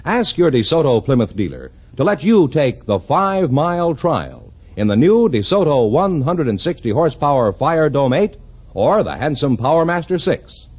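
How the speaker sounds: ordinary delivery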